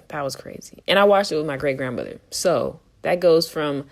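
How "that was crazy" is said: whispered. Shown as a demonstration. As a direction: repeat "That was crazy" as a breathless whisper.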